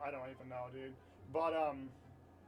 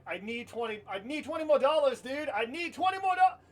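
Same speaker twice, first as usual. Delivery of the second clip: higher, louder pleading; begging; 7 semitones higher, 8 dB louder than usual. Retold or imitated. Begging older brother for hat money, high loud pleading. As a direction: beg hard for cash, pitch up and loud. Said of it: "I had to beg him".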